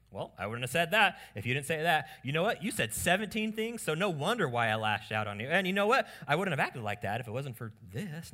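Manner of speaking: mock indignant self-justifying; defensive blame-shifting. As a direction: argue back and pile up excuses. Acted blame-shifting spouse quarrel.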